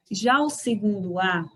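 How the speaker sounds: usual speaking voice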